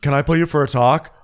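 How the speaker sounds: high, loud friendly call; hammed-up cheerful summons; mock call-out. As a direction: Julia calling Gabby aside, high and loud. Voicing Julia pulling Gabby aside.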